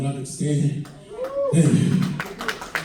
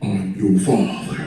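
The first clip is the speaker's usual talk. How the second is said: much lower, slow heavy-breathing villain; mock Vader boom; Darth Vader; 9 semitones lower, slower than usual. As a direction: drop deep and breathe like Vader. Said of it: "breathing like Darth Vader".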